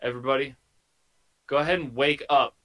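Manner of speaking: ordinary talk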